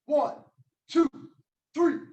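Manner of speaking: loud, high shouted "one"; shouted count. Shown as a demonstration. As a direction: bark the count. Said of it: "here's the yell".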